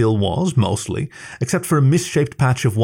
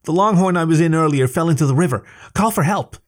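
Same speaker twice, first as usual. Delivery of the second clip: yelling for help; 7 semitones higher than usual. A narrator's reading. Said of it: "Sam yelled at them".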